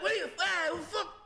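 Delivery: high, sputtering mock outrage; indignant mobster. Old mobster answering the salesman, high indignant sputter. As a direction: sputter like an offended tough guy, high-pitched.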